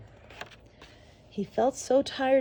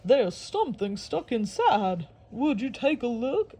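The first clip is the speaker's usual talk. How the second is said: a lightly voiced alligator; 2 semitones higher than usual. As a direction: ask in the alligator's voice.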